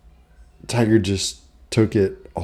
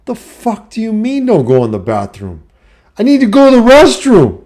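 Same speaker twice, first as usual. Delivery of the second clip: much higher and louder outraged pleading; indignant protest; 12 semitones higher, 7 dB louder than usual. Retold or imitated. Son protesting he needs the restroom, outraged. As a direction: squeal in disbelief.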